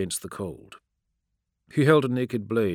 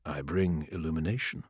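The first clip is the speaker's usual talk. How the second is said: lower, softer, measured emperor; a lightly voiced cold emperor, lower and softer; calm emperor; 4 semitones lower, 6 dB quieter than usual. A narrator's reading. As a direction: speak as an unmoved ruler passing sentence.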